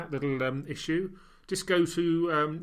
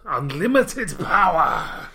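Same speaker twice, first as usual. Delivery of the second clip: evil emperor voice; 6 dB louder, much slower than usual. Pretend. Mock villain answering power question.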